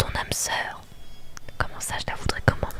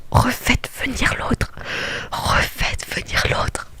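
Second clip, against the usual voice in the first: slow, forceful whisper, louder on "Refaites venir l'autre"; emphatic whispered plea; 4 dB louder, slower than usual. Real, not acted. Player begging for the other suitor back, emphatic whispered plea.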